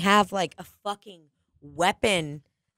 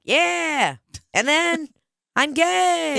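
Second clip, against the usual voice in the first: Mark Normand cadence; 8 semitones higher, much slower than usual. Retold or imitated. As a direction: pitch it up and toss it off as a bit. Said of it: "he's got a very".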